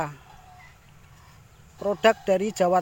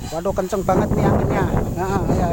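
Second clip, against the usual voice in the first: loud, fast, raised over the wind; shouting over wind; 6 dB louder, faster than usual. Real, not acted. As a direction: call out over gusting wind. Strong wind in the field. (berteriak melawan angin)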